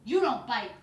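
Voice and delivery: loud stern no; firm loud correction. Shown as a demonstration. Trainer holding the dog down, loud and stern. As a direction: bark it firmly at the dog. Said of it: "I would go like this".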